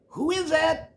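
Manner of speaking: a granny calling through the door; Grandma's voice. Acted.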